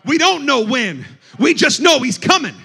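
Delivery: mounting pulpit fervour; forceful preaching. Acted. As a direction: drive each phrase harder, climbing in pitch. Preaching, building.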